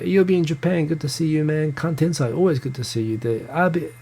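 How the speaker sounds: usual speaking voice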